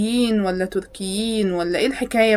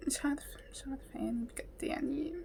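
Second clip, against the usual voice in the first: whispered under her breath; hushed words to herself; 3 semitones higher, 16 dB quieter than usual. Real, not acted. Whispering confusion while watching video. (همس)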